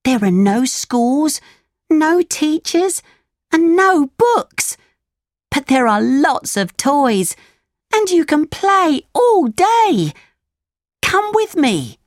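put-on excited boy voice. Lampwick voiced in story.

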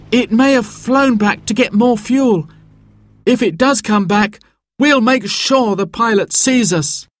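put-on high-pitched, earnest child's voice; hopeful boy. A narrator's reading. Simon tells Sarah the helicopter may return, hopeful. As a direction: speak up high with hopeful resolve.

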